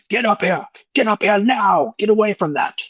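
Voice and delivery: high, urgent monster shout down a hole; bugbear yelling. Acted. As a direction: bark orders like a bugbear.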